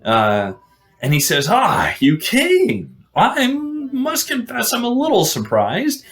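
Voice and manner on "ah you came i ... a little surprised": high, drawn-out mock surprise; a hint of a lofty knight, pitched up and slow; lightly voiced Green Knight. Green Knight greets Gawain at the chapel, lightly acted surprise.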